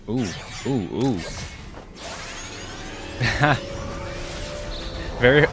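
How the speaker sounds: ordinary talk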